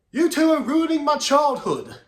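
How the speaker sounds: yelled in a bad British accent; high, loud, hammed-up British yell; Rockstar Spud. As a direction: holler it across the room in a terrible fake British accent. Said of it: "That was a terrible British accent".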